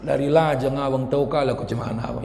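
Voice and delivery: low, soft acted complaint; nagging wife. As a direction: grumble quietly at a husband, pitched low. (istri mengomel pelan)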